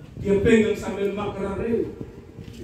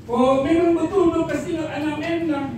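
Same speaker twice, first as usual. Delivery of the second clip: lightly voiced widow; 7 semitones higher than usual. Widow answers the mourners.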